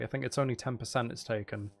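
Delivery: ordinary talk, nothing marked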